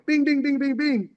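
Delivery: high quick punch sounds "bing, bing"; fight punch noises. Retold or imitated. Teller sounding out the punches in the bus fight. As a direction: mimic punches landing.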